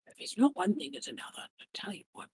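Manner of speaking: muttered under the breath, fading away; mumbling to himself. Acted. Villain grumbling to himself after giving in, muttered. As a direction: grumble privately as you shuffle off. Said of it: "Mumbles to himself".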